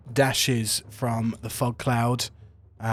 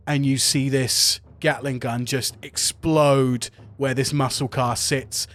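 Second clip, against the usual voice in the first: ordinary delivery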